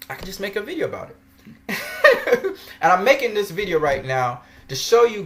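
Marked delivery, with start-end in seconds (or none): 1.5-2.8 high-pitched burst of laughter; amused cackle at his own plan. Real, not acted. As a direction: let out a giddy high laugh. Gloating over making the video.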